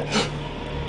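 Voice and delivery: sharp gasp. Retold or imitated. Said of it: "people instantly said".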